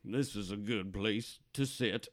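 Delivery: lightly voiced old regular at the bar; a hint of a rambling old drinker; old-timer sharing his wisdom, lightly played; old barfly. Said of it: "the old guy at the bar".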